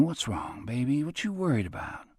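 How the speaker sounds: soft and soothing, easing her worry. Acted. Robert comforting worried Anne, soft and soothing. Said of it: "showed her he was as sensitive".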